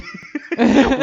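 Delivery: a loud, high laugh; high burst of laughter. Real, not acted. Laughing while retelling the student's complaint. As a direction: crack up at the memory.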